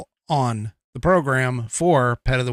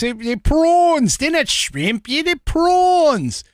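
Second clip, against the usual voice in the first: much higher, slow hammed-up Aussie accent; mock Australian accent; 11 semitones higher, 3 dB louder, slower than usual. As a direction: overdo the Australian vowels on prawns. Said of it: "Americans trying to accents".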